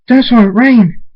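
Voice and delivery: high, very loud call; screamed names. A narrator's reading. Rain Dash screams for father and daughter, loud call. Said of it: "And Rain Dash screams".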